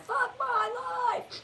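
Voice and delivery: high, drawn-out wail of despair; anguished yell. Retold or imitated. Retelling a neighbour who yells into the mirror, anguished imitated yell. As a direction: yell it high, stretching every word out. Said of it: "because he yells into the mirror".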